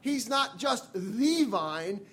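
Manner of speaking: usual speaking voice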